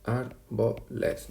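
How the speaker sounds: very slow, each syllable drawn out; very slow reading. Shown as a demonstration. Teacher to students, reading the example verse very slowly. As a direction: stretch the line out to count it. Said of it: "Nos saldría una".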